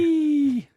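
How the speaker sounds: high, drawn-out mock squeal; playful whee. Imitates pig hoisted and slid along.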